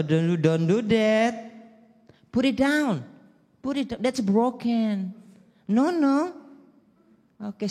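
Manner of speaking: high, gentle English-speaking rich dad; posh mall dad. Retold or imitated. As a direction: coo at the child like a rich Jakarta father. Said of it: "Orang tua dari jauh cuman".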